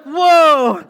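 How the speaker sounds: long, high, very loud "whoa"; kid's stunt yell. Retold or imitated. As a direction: hold out a big mock holler. Imitating child's long stunt cry.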